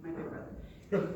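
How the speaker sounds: normal talking voice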